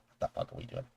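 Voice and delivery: hushed, disbelieving whisper; a whispered aside to himself. Retold or imitated. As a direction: breathe it out in shocked disbelief. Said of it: "and I was like".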